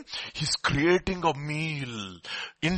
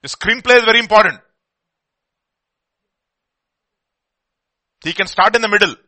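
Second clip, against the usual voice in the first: forceful preaching; 5 semitones higher, 10 dB louder, faster than usual. Real, not acted.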